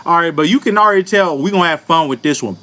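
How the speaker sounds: usual speaking voice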